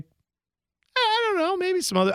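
squeaky pretend disinterest; mock dismissive shrug. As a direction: brush off the swag in a put-on voice. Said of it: "And I was like".

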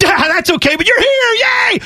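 forgive him like an overjoyed dog; happy dog voice. Retold or imitated. Host voicing his unfed dog still overjoyed, very high. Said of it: "He's like".

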